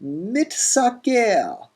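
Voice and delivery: mitsaker with t before s, mit-saker; expected form mitsaker. Shown as a demonstration. Teacher giving the expected form, mitsaker. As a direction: say mitsaker, t first. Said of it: "we would expect mitsaker".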